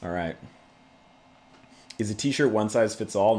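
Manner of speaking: ordinary talk, nothing marked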